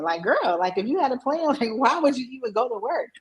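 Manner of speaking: high, laughing mock disbelief; incredulous self-teasing. Woman questioning her own past plan, amused and incredulous. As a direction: tease yourself, baffled and amused.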